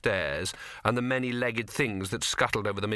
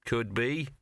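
grudging; slower than usual. A narrator's reading. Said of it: "said the dog grudgingly".

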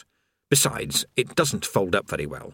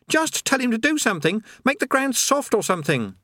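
urgent plea; 12 semitones higher than usual. Acted. Eric pleading mid-fall.

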